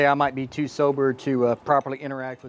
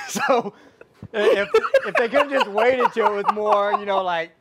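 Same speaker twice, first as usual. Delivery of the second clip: laughing; 7 semitones higher, slower than usual. Real, not acted. Co-host laughing at own dark joke.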